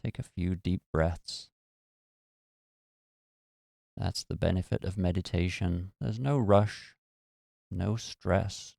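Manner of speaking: calm, even meditation voice with long pauses; guided meditation. Acted. Host leading listeners through a relaxation meditation, calm and unhurried.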